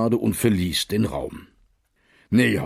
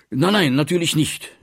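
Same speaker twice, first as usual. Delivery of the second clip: hasty; 7 semitones higher, 3 dB louder than usual. A narrator's reading. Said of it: "sagte Wehrmann rasch".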